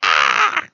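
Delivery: high, loud cat yowl; mock cat yowl. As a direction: answer like a screaming Siamese. Cat screams back, voiced.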